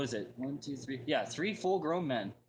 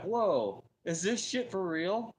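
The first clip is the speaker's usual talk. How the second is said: stunned disbelief; 7 semitones higher, 3 dB louder, slower than usual. Retold or imitated. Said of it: "I'm like".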